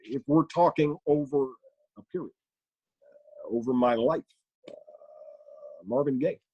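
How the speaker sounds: halting statesman with long hanging pauses; stop-and-start presidential deliberation; Barack Obama. Acted. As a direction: stutter and hedge toward a second answer. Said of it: "here, ask Obama a question".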